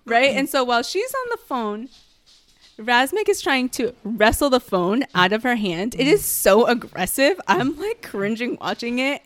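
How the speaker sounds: ordinary talk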